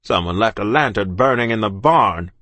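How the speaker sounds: loud snarling captain voice; snarled. A narrator's reading. The captain snarls about a lantern left burning in the barn. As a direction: growl it out at the servants. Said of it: "he snarled".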